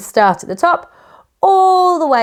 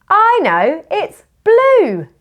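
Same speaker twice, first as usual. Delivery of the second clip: mock excited discovery; 8 semitones higher, slower than usual. Gasp, pretend discovery.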